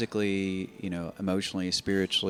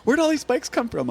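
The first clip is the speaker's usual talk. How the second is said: much higher and louder, shrill mock-wife voice; 10 dB louder than usual. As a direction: ham up the wife's shocked outburst. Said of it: "She goes".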